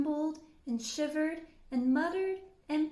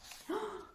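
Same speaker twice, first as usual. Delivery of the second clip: sharp gasp; 8 semitones higher, 5 dB quieter than usual. Acted. Suspense gasp.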